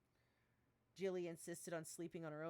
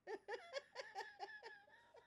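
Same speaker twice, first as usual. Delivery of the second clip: squealing high-pitched giggle; high embarrassed giggle; 4 dB quieter than usual. Real, not acted. Embarrassed by own writing.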